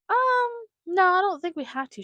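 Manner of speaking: high, loud retold doubt; mock hesitant objection. Mother retelling her answer to daughter's bathroom rule, mock doubtful. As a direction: act out an exaggerated, doubtful no.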